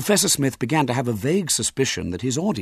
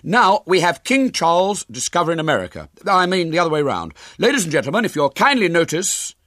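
put-on high, loud young showman; big presenter voice, a touch flustered; slightly put out; 10 semitones higher, 7 dB louder than usual. A narrator's reading. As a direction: push on with the show despite interruptions. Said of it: "said William, who was slightly put out".

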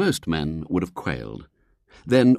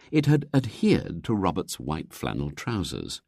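plain reading voice

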